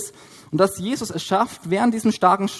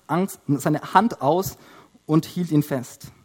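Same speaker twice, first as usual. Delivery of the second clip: ordinary talk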